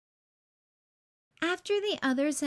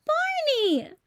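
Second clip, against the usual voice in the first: a high, louder, beaming call of the name; jolly greeting; 5 dB louder than usual. A narrator's reading. Santa wakes up and greets Barney, jolly high call. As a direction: greet an old friend with delight. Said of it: "laughed Santa".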